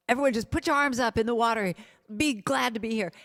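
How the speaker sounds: rally the swimmers to be glad they're here; coach's morning call. Retold or imitated. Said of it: "he would always start every morning going".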